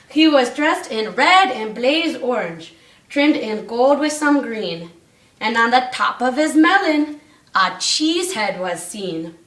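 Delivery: rhythmic read-aloud storytelling; bouncy storybook reading; story narration. A narrator's reading. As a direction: read the rhyme to the class. Reads rhyming Christmas story aloud.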